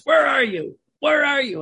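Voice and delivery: high, loud calling-out, imitating the old pastor; deaf priest. Deaf priest calls to a small boy in the confessional, high and loud. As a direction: call out for someone you can't locate. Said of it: "the other thing too is he was deaf".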